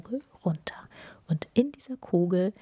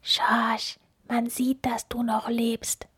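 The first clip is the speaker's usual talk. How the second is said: higher-pitched, voiced mock whisper; stage whisper; 5 semitones higher, slower than usual. A narrator's reading. Hare secretly warning the snowman playing dead, stage-whispered. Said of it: "flüsterte Holm".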